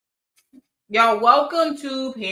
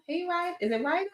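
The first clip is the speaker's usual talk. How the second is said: usual speaking voice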